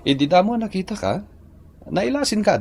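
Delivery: high, fast, eager rush; Ricky gushes to his wife about recognizing her, high and fast; excited. Acted. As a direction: gush it out quickly.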